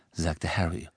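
ordinary delivery

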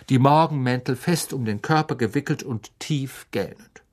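ordinary delivery, nothing marked

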